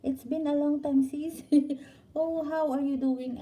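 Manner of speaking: high, bright-eyed hello to viewers; excited greeting. Real, not acted. Greeting live-stream viewers by name.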